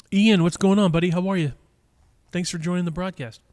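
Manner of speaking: loud, bright hello to a regular caller; Sean's cheery greeting. Retold or imitated. Shop clerk Sean greets Matt on the phone: loud and cheery. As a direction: greet him like an old buddy, full volume. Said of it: "the gentleman answers the phone".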